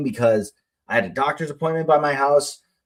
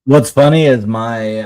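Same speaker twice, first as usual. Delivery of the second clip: ordinary talk, nothing marked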